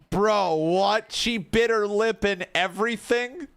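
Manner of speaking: can't believe what you just saw; stunned yelp of disbelief; shocked disbelief. Real, not acted. Reacting to game cutscene.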